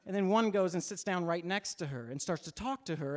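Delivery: plain reading voice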